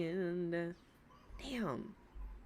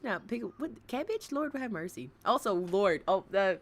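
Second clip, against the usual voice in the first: normal talking voice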